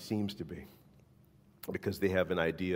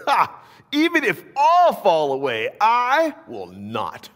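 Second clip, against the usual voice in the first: high, loud cocky boast with a scoffing ha; Peter's boast; 11 dB louder, slower than usual. Acted. Peter boasting loudly to Jesus, proud and defiant. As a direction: declare it like a proud disciple who won't fail. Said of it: "Peter declared".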